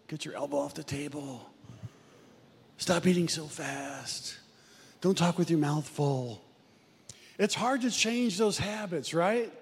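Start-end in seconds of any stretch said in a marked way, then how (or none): 0.1-6.4 wife's gentle reminder. Retold or imitated. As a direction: lower and patient, mildly correcting. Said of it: "Janie will say with a gentle elbow".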